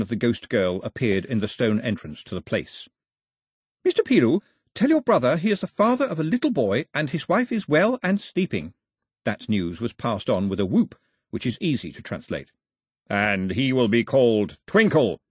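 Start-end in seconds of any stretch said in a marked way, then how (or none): 3.8-8.7 put-on higher, bright young woman announcing; ghost girl. 13.1-15.2 put-on halting, lightly accented foreign English; slow careful Twinkle in stilted English; broken English. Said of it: "in broken English".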